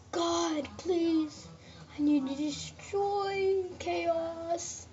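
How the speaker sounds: toy's voice. Pretend. Kid voicing his toy begging God, slow and high. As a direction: beg slowly in a put-on hero voice. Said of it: "He's, like, saying".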